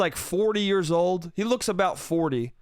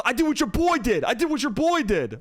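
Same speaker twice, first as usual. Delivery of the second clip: higher, punchy repeated comeback; mock defiance; 7 semitones higher than usual. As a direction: imitate a drunk defending himself stubbornly. Drunk man defends himself.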